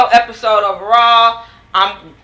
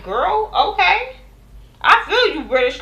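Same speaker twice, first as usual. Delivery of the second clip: mock reaction; 9 semitones higher than usual. Host voicing her own reaction.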